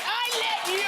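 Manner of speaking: high, pointed mock scold at the driver; indignant quote. Driver who merged without a nod, high mock scold. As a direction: tell them off as if they owe you. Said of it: "go up to them and be like".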